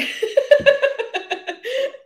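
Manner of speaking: long, high, loud giggle; giggling laugh. Real, not acted. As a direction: run of squeaky real laughter. Laughs at own childhood home joke.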